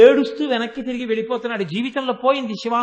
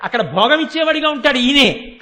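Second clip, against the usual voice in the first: ordinary talk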